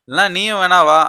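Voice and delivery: high, loud coaxing invitation; husband coaxes his annoyed wife to come out, high and loud; eager invite. Acted. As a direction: win her over brightly.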